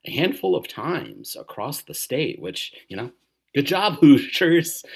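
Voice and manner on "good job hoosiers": loud, higher-pitched congratulations with a laugh; laughing cheer. Real, not acted. Podcaster to fellow Hoosiers who fought data centers, laughing cheer. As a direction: cheer them on, laughing as you go.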